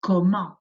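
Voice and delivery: "Comment" said ko-MAHN, nasal ending, final t silent; French word comment. Shown as a demonstration. Teacher models "Comment" for pupils to repeat. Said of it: "Repeat after me".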